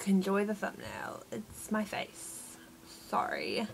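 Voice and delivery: deadpan. Retold or imitated. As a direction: mumble the apology as if embarrassed. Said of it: "a second ago I was like".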